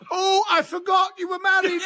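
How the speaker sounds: mocking laugh. Johnson jeering at Bozzy before the company, a high laughing shout. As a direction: crow over him and burst out laughing.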